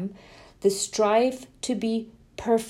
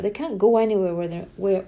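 usual speaking voice

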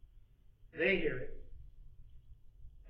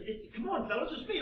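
coaxing; 10 semitones higher than usual. Acted. Joseph coaxes stunned brothers.

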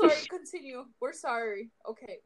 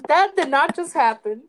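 shocked disbelief; 4 semitones higher, 11 dB louder than usual. Real, not acted. Reacting to the botched intro.

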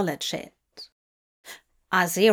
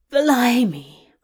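startled, louder outburst; surprised exclamation; 2 semitones higher, 5 dB louder than usual. Acted. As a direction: blurt it out in surprise. Inner exclamation in story.

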